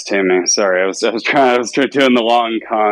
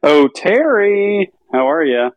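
slow, much higher, overly friendly greeting; fake friendly caller; 10 semitones higher, slower than usual. Prank caller greeting the man on the phone, slow and higher. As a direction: draw out the name like an old friend.

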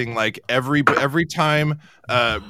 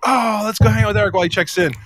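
fast, high, bright eager exclamation; eager quote; 9 semitones higher, 5 dB louder, faster than usual. Retold or imitated. Host retelling her eager plan to hang out. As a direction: rush it out, all keen. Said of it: "and I'm like".

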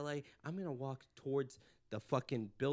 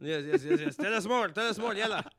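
loud, higher pleading cheer; eager mock crowd; 7 semitones higher, 7 dB louder than usual. The imagined crowd begging for more, loud and high. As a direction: beg for more like a thrilled crowd. Voicing eager Middle East audience.

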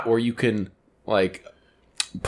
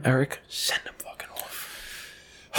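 slow hushed whisper; 15 dB quieter, much slower than usual. Real, not acted. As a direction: whisper it slowly off-mic. Whispered aside during the outro.

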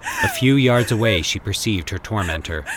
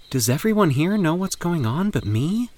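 suspicious thought; 9 semitones higher than usual. A narrator's reading. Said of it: "she thought".

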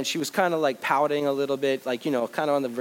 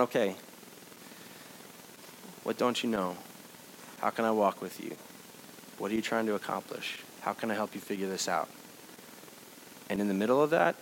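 softer, lower, tender reassurance; gentle God voice; 6 semitones lower, 9 dB quieter than usual. Retold or imitated. God speaking to struggling adults, softer and tender. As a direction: comfort the doubter quietly.

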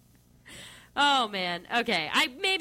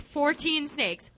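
ordinary talk

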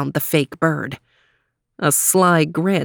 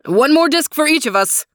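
barked order; 8 semitones higher than usual. Acted. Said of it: "he barked".

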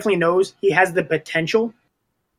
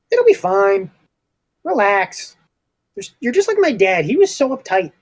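high-pitched breezy brush-off; carefree, dismissive young Melkor; 7 semitones higher than usual. Acted. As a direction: wave away the nagging, pitched up. Player plays dismissive Melkor.

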